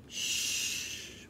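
long voiceless shush; sustained "shh"; hushing. A narrator's reading. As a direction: hush them with a drawn-out shh. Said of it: "softly nodding at the straw".

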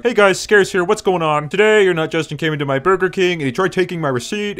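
high, put-on YouTuber intro voice; Scarce impression. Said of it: "That's not actually what he says".

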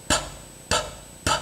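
"p" as a bare puff of air; voiceless P. Shown as a demonstration. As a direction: push air out for "p" with no throat buzz. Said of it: "nó chỉ là một làn hơi được đẩy ra". (âm "p" vô thanh, chỉ bật hơi)

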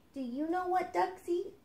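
a small duck's hopeful question; duckling voice. Acted. Duckling asks the snail.

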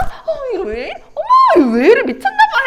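high, loud mock scolding from a delighted mother; hammed-up motherly fluster, pitch leaping up; flustered mom. Mom flattered by her child's compliment, high mock protest.

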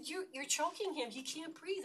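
high, loud desperate yell; screaming at a cop. Retold or imitated. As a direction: shout it at him to make him stop. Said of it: "And I'm screaming".

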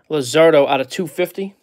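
usual speaking voice

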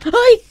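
high, loud yelp; startled yelp. Real, not acted. Player startled by a spider getting through, startled yelp. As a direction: yelp in sudden fright.

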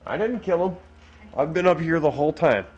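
high, insistent protest; protesting innocence. Real, not acted. Protests to child about tooth fairy.

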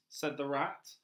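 plain reading voice

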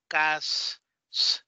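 cas- broken off with a drawn-out final "sss"; phonics-style cas-, then isolated s; sounding out. Shown as a demonstration. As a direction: hold the S of cas- on its own. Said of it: "de esa manera les enseñaron los sonidos".

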